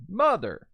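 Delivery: mother with MU punched; stress on first syllable. Shown as a demonstration. Teacher models the stressed syllable, strong MO in mother. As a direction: hit the MO of mother, let the end drop. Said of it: "Put the emphasis on the mother".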